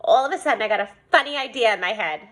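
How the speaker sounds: usual speaking voice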